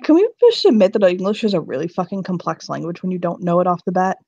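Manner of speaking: normal talking voice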